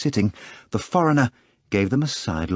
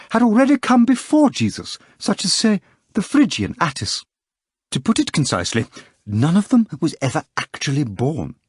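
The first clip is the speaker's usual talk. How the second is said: higher, earnest lecturing voice of an editor; Berlioz lecturing; 9 semitones higher, 3 dB louder than usual. A narrator's reading. Editor Berlioz lecturing the poet Ivan, higher and insistent.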